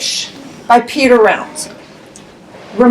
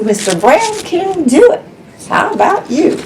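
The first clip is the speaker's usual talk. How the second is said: plain reading voice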